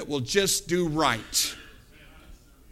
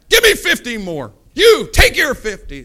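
loud, high, angry orders; mad king; 6 semitones higher, 8 dB louder than usual. Acted. King sending another captain after Elijah, mad. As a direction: yell commands like a furious king. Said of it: "The king is still mad".

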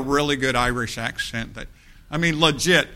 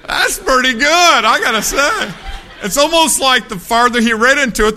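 delighted praise; 9 semitones higher, 7 dB louder than usual. Real, not acted. Praising the reading over applause.

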